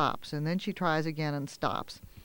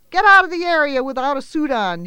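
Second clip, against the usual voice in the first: much higher, much louder bark of an order; lifeguard yelling; 9 semitones higher, 11 dB louder than usual. Acted. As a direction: order her off the beach. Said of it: "yelled a lifeguard at her".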